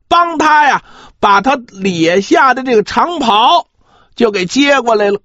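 ordinary delivery

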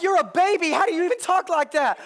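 put-on stunned dad asking how a baby talks like that; shocked father; incredulous. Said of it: "I'm like".

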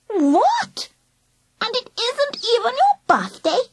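put-on high babyish disbelief; squeaky, incredulous child voice; mock-astonished toddler. A narrator's reading. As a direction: echo in shocked little-girl questions. Child echoes woman's denials, in pretence.

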